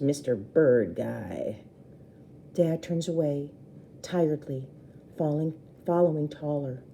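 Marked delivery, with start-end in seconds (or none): 0.0-1.6 slow, low mocking repeat; low sneer. A narrator's reading. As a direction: sneer it under the breath.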